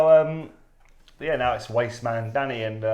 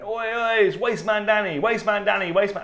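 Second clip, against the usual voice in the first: high, lively shout-out; mate's excited hailing; 10 semitones higher, 4 dB louder than usual. Retold or imitated. Mate hailing him on arrival, excited hailing. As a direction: hail a pal with a teasing nickname. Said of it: "my mate Ralph ... going".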